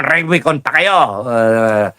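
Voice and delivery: ordinary talk, nothing marked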